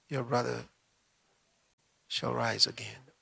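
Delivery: low, soft and steady; calm Jesus. Acted. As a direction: speak with quiet assurance. Jesus speaks calmly.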